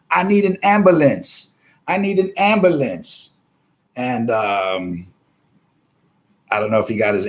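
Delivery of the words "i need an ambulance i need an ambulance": high mock plea; mock crying for help. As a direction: whine it like a beaten guy begging, pitched way up. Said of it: "what he was crying for".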